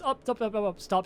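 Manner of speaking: high, loud rapid calls to break up the fight; Randy rushing between them to stop it; urgent interjection. Acted.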